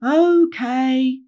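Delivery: loud, high, drawn-out assent; chorus it back like reluctant children; group reply. A narrator's reading. Wheelie Hogs all replying to Rainbow, loud drawn-out agreement. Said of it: "the Wheelie Hogs all replied".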